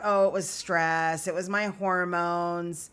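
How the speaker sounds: slow, drawn-out excuses; slow sing-song list of excuses; mocking excuses. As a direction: drag out the lame excuses. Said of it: "make excuses".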